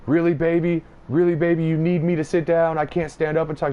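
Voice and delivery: high, sarcastic mock of Justin snapping at his wife. Justin sarcastically answering his wife's plea to sit, high mocking voice. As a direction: sneer it back, high and mocking. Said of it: "And he's like".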